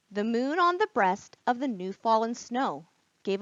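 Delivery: put-on lively read-aloud for children; teacher reading The Night Before Christmas to kids, animated; storybook reading. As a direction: read it with wide-eyed wonder, like bedtime.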